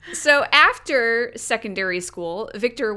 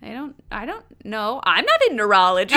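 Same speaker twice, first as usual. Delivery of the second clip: usual speaking voice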